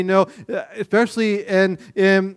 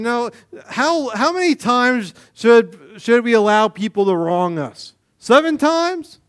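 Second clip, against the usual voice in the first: disciples asking; 7 semitones higher, 4 dB louder than usual. Retold or imitated. Voicing disciples asking Jesus.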